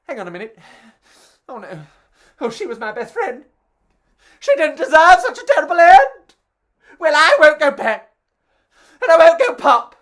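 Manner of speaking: a sausage mourning, rising to a loud high wail; rising from hushed to a loud high wail of grief; lightly voiced grieving sausage. Sausage grieving its best friend, building to a loud wail.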